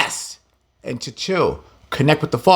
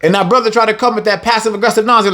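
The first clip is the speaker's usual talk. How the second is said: normal talking voice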